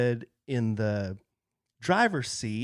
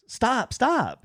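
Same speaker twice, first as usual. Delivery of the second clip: calling out; 10 semitones higher than usual. Retold or imitated. Lady waving him down from car.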